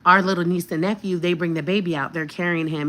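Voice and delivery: ordinary delivery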